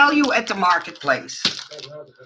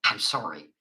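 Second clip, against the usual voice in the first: usual speaking voice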